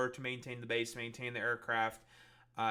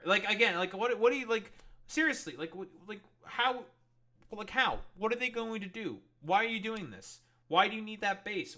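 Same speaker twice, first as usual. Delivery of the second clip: higher, louder, incredulous ranting; pitched-up exasperated questioning; exasperated disbelief; 8 semitones higher, 6 dB louder than usual. Real, not acted. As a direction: demand answers in loud, high disbelief. Incredulous rant about Trump's base bid.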